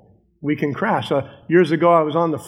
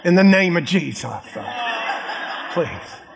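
laughing mock prayer; 3 semitones higher, much slower than usual.

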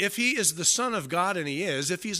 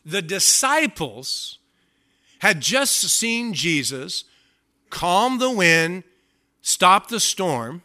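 slow, louder, each phrase landed; slow emphatic; 3 semitones higher, 8 dB louder, much slower than usual. Real, not acted. Preacher listing miracles to congregation, slow and emphatic. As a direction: lay out each miracle slowly.

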